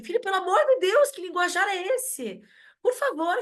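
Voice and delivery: high-pitched scandalized mother, pleading; shocked mom scolding. Retold or imitated. Mother scolds her son for swearing at school, high and shocked. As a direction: clutch your pearls at your son's language.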